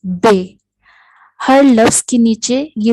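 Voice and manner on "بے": بے with a short clean e; teacher modelling بے as the Turkish letter b; Turkish letter name. Shown as a demonstration. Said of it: "ترکی زبان میں اس کو کچھ ایسے بولا جاتا ہے".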